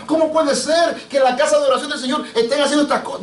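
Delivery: high, anguished mock outcry; imitated tearful plea. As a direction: act out Christ's tearful reproach, pitched high. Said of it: "Cristo estaba llorando cuando estaba echando".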